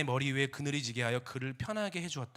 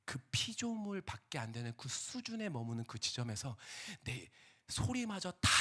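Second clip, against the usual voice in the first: usual speaking voice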